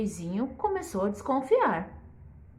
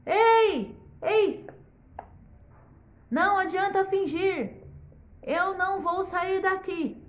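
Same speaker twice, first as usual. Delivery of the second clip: high calling shout to the door; a king yelling at a closed door; 9 semitones higher than usual. Acted. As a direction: call out insistently, raised high. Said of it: "Começou a gritar".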